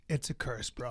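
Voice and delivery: low, soft deadpan imitation; mock-solemn quote. Co-host confiding his curse, imitated low and soft. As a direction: drop it low and quiet. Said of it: "Brendan looks at me and goes".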